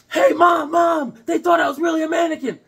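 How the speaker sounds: put-on high, loud child shouting; excited kid shout. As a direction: yell it like a thrilled little boy. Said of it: "and then he goes".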